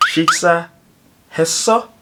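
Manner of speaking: "Shiksa heso" with "heso" climbing high; rising intonation. Shown as a demonstration. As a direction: pause after "Shiksa", then lift "heso" into a question. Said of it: "with a rising intonation".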